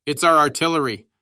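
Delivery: loud, high cry of relief across the cellar; a soldier calls out over the guns, shouting loud; shouted. A narrator's reading. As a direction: shout it out with sudden hope. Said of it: "Then someone shouted".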